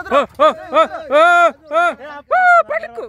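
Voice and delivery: high, loud laughing yelps; excited laughing cries. Real, not acted. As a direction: yelp and laugh as the swing goes. Swing scare while laughing.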